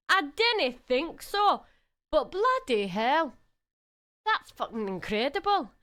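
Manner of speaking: playful put-on Scottish accent held through the stream. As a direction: lean into a light Scottish brogue, rolling the words. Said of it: "I don't know what happened to my accent".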